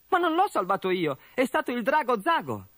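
put-on high, bright boyish voice; a grateful young orc, pitched high. Boy corrects his father.

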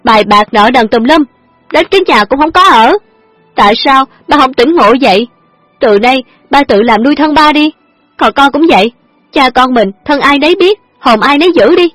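very high, loud tirade; furious talking back. A narrator's reading. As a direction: let all the anger out at him. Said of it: "trả treo".

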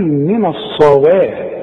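من الصواعق slow, the و kept thin; correct recitation. Shown as a demonstration. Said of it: "خلاص يبقى هنقول".

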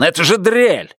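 a husband ordering his wife around, menacing; bullying order. A narrator's reading. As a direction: bark it like a tyrant. Buster furious.

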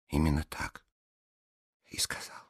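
soft, fading to breath; hushed. A narrator's reading. Alice quietly insisting to the blonde, hushed. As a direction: let it drop to a whisper.

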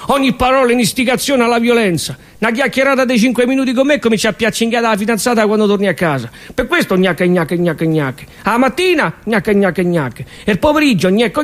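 normal talking voice

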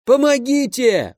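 high, slow desperate yell; yelling for help. A narrator's reading. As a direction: cry out as if being tortured. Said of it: "вдруг заорал за моей спиной степняк". (отчаянный крик о помощи)

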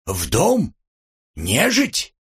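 incredulous questions leaping up high; scandalised, high-rising disbelief; startled disbelief. A narrator's reading. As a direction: fire off two aghast questions, each rising sharply. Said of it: "даже подпрыгнул на месте Антип".

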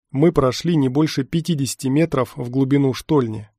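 ordinary delivery, nothing marked

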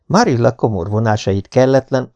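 ordinary delivery

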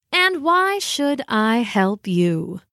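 slow, drawn-out feline challenge; aloof cat. Acted. Cat asked for help by the dog, slow and aloof. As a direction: make them earn it, as a haughty cat.

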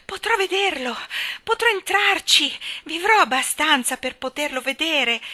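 high, loud, gasping hope; breathless excitement. Acted. Frail Colin to Mary, high breathless wonder. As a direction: push it out between big breaths. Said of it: "fra un sospirone e l'altro".

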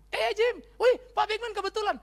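loud, high-pitched hail; surprised greeting. Acted. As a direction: act out bumping into a friend, calling out high and loud. Acting out a surprised chance meeting.

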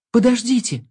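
high urgent call to stop; shout. A narrator's reading. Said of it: "крикнула девочка".

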